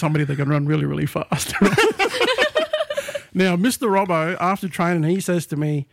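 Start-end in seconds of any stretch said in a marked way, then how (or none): 1.6-3.3 high laugh breaking out. Real, not acted. Laughing at his own Twinkle Toes nickname, high laugh.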